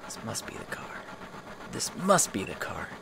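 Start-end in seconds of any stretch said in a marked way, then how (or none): 0.0-0.8 whispered, breathy under the breath; hushed aside. Shown as a demonstration. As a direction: whisper it to yourself. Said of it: "repeated take".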